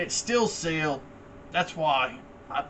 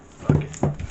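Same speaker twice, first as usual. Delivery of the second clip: breathy shrug of a fuck it; a resigned whispered aside; whispered curse. Real, not acted. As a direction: toss it off under your breath. Shrugs off the torn record.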